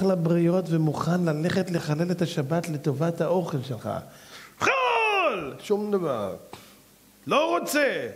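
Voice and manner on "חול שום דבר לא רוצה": a sudden high exclamation; high, ringing outburst of refusal; defiant cry. Acted. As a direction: fling the word out in defiance. Preacher mimics defiant refusal.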